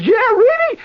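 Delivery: high, loud disbelief; can't believe the news; surprised. Retold or imitated. Aki hears the war is over, high and amazed. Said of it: "Aki says".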